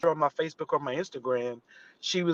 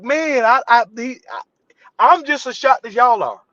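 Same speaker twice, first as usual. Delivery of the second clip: high, louder flustered stammer; flustered shock; 7 semitones higher, 6 dB louder than usual. Retold or imitated. Extra answering the crowd, flustered and high.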